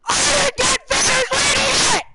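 loud high-pitched yell; high shout. Real, not acted. Shouting at game character.

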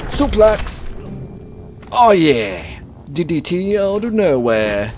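wrestling announcer yell. Real, not acted. Gamer calling his own suplex like a wrestling show, loud. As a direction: call the move like a wrestling announcer.

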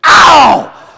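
sudden loud high yelp of pain; sharp shout of pain. Retold or imitated. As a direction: yelp as the thumb pops out.

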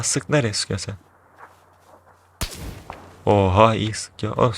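ordinary delivery

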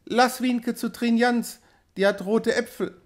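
put-on high, eager child's voice; small child calling. A narrator's reading. Child turning from mother, high sudden request. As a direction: pipe up like a little girl. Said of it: "rief sie".

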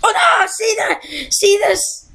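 high, alarmed yelp at the screen; panicked outcry. Real, not acted. Gamer yelps at the screen in sudden alarm. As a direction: cry out as the jump goes wrong.

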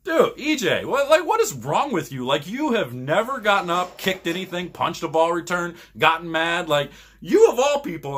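a bowler yelling back at his hot-headed friend; heated comeback. Retold or imitated. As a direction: fire it right back at him, incredulous.

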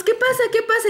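high-pitched, excited re-enactment; urgent retold questioning. Three friends run up asking what's happening, urgent and high. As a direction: imitate alarmed questions, pitch jumping up.

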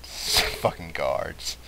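ordinary talk

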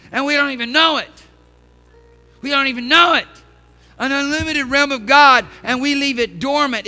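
loud, high, impassioned pulpit shout; full-force preaching. Real, not acted. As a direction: preach it at full volume, driving each phrase home.

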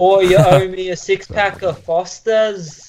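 slow, high, hammed-up Aussie accent; mock Australian. Friend doing a hammy Australian accent for laughs. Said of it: "What the fuck is that?".

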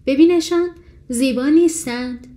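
a beaming mother duck showing off her brood; proud. A narrator's reading. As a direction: show off the babies proudly. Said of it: "با غرور گفت".

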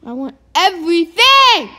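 loud, high greedy yell; excited shout. Real, not acted.

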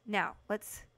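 usual speaking voice

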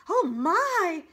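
high, loud cry of shock; alarmed gasp. Acted. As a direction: gasp out in sudden horror. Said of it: "the opossum gasped".

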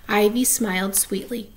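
usual speaking voice

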